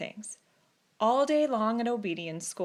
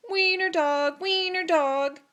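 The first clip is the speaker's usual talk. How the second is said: higher, louder sing-song taunt; laughing taunt; 7 semitones higher, 5 dB louder, slower than usual. A narrator's reading. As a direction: chant it to mock him. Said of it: "laughed the other dogs".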